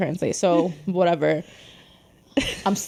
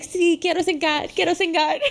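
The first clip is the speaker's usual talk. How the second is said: put-on higher, insistent kid's chant; eager child; 8 semitones higher than usual. Eight-year-old insisting to laughing family friend, eager child. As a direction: pipe up like an eager little kid. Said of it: "I'm, like, eight or nine".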